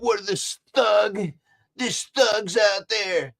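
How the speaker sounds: a hint of hyped-up news drama; mock media voice. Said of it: "spread it on a little bit".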